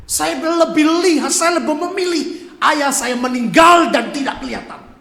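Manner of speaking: anguished outburst. Real, not acted. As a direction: shout it out at full force. Climax of painful testimony.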